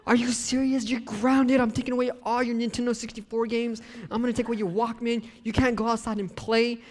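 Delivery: scolding mom. Retold or imitated. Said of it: "my mom was like".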